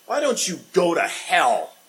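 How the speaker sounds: a loud snapping quarrel line; mock heated argument; angry retort. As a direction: throw it at them in an argument. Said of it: "where someone said".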